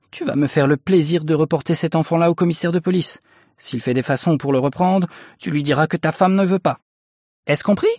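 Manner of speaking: high-pitched, angry wife's command; menacing order. A narrator's reading. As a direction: lay down the law to your husband. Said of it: "avec un geste menaçant".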